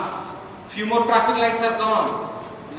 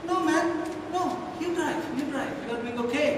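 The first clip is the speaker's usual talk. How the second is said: mock George; 8 semitones higher, 4 dB quieter, much faster than usual. George voiced softly.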